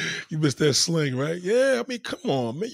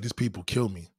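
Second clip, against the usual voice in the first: ordinary talk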